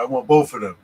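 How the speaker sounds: put-on New York street accent. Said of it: "in the street with certain Yankees".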